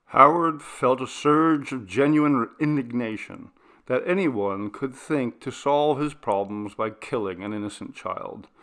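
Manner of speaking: ordinary delivery, nothing marked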